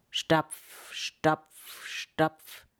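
ominous tread getting closer; nearing footsteps. A narrator's reading. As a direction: land each word like a firm step. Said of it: "Und es wurde lauter".

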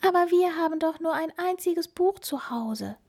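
high, earnest mouse squeak; meek piping voice; apologetic mouse. A narrator's reading. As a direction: plead sorry as a tiny mouse. Mouse explains in character.

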